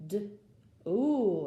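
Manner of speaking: usual speaking voice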